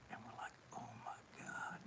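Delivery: stunned whisper. Retold or imitated. Band whispering their shock at the porch, hushed. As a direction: breathe it out in awe.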